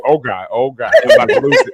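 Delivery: holding back laughter. Retold or imitated. Telling how she struggled to keep composure, stifled laughter. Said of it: "hold it together".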